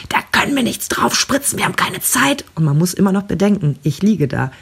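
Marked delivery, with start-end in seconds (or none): 0.1-2.4 high, strained yell at no real volume; retold angry shouting. Doctor shouting at the anaesthetist that there is no time, mock yelling. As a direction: mimic an irate doctor, pitched way up. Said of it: "Und dann schreit er die an".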